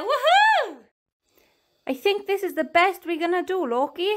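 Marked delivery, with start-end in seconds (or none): 0.0-0.8 high, loud woohoo; excited cheer. Real, not acted. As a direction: whoop with joy. Cheer before holiday.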